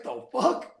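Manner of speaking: high, startled "the fuck"; mock startled girlfriend. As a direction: squeak it out in drunken shock. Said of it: "And she's like".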